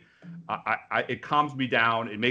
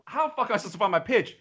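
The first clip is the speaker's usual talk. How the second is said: exasperated complaint; 9 semitones higher, faster than usual. Retold or imitated. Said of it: "And I'm like".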